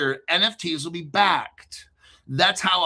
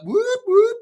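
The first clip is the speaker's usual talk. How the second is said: high, loud mock siren whoop; police siren imitation; 7 dB louder than usual.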